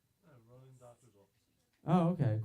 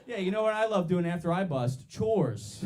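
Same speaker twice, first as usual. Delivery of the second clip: normal talking voice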